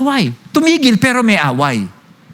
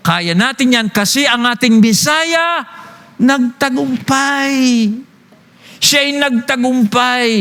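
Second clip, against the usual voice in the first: slow, emphatic sermon delivery, higher; preaching; 4 semitones higher, slower than usual. Acted. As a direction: preach it, drawing out key words. Preaching at full force. (nangangaral nang mariin)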